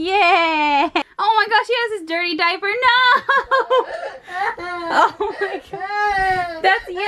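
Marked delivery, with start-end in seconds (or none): 2.8-7.0 laughing. Real, not acted. Dirty diaper discovered.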